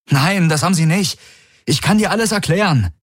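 high, pressing protest; urgent denial. A narrator's reading. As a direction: protest and beg to explain. Accused man hastily denies it. (hohes, drängendes Abstreiten)

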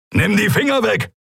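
high-pitched, frantic command; urgent shouted order. Acted. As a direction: order hands off in a panic.

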